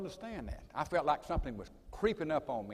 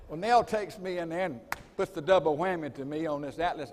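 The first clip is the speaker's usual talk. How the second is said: ordinary delivery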